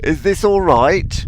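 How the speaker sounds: a wary horse asking if it's okay; lightly voiced horse checking in; horse impression. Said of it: "do you like my impression of a horse then".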